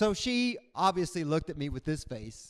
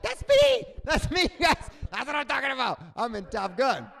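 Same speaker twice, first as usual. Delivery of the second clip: much higher and louder, thrilled to be called out; gleeful excitement; 8 semitones higher, 7 dB louder than usual. Retold or imitated. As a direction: shout with delight at being named. Excited self at movie line.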